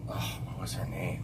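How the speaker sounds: hushed, half to himself; whispered wondering. Real, not acted. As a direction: whisper to yourself, trying to remember. Straining to recall name.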